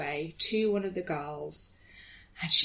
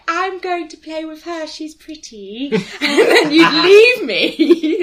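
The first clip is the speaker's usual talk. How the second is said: teasing imitation; 9 semitones higher, 14 dB louder, slower than usual. Friend imitating her at club.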